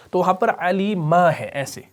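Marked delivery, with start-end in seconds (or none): none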